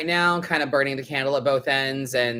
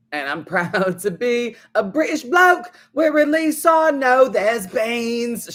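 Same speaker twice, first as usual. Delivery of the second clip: higher, slow bad British accent with a laugh; mock British accent; 7 semitones higher, 4 dB louder, slower than usual. Quoting the Beans song to her co-host in a bad British accent. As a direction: ham up a British bloke accent, giggling. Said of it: "the bad British accent".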